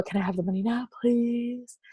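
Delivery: soft asking. Shown as a demonstration. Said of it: "I was using that tool".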